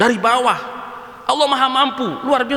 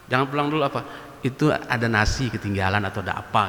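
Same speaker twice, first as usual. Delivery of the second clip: hushed teasing; 13 semitones lower, 5 dB quieter than usual. Acted. Telling preacher about his face.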